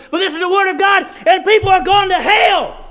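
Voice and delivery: real fire-and-brimstone fervor; worked-up preaching. Real, not acted. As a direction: proclaim it urgently at full force. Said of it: "sometimes I get so worked up".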